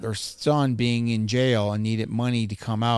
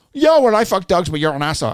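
mock furious caller, louder and higher; angry scammer; 4 semitones higher, 7 dB louder than usual. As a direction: ham up the scammer blowing his top. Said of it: "I got him so fucking mad".

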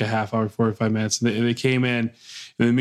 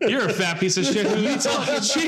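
high, blunt joking insult; mock blunt insult; 8 semitones higher than usual. Joking what he really meant.

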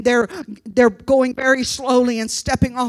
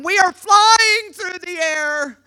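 higher, drawn-out cry of dismay; horrified exclamation; 7 semitones higher, 4 dB louder, slower than usual. Retold or imitated. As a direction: stretch it out high, reliving the horror. Horror retold at full pitch.

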